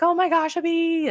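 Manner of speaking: put-on kids freaking out. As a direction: mimic the kids shrieking over a bee. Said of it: "they're freaking out".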